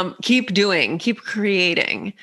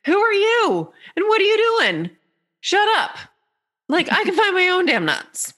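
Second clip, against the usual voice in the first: annoyed squirrel; 12 semitones higher than usual. Pretend. Squirrel snapping at the bird for butting in, high indignant squeak. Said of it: "the squirrel looks up and is like".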